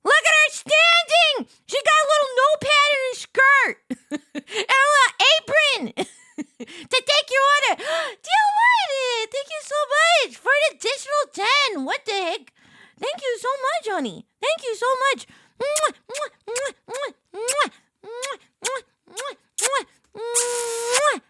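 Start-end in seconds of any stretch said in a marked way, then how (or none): 0.0-13.9 gushing excitement. Real, not acted. Streamer gushing over the plushie's apron, high and loud. 15.6-21.2 kiss after kiss, squealed high; blown kisses. Real, not acted. Blowing kisses to donor.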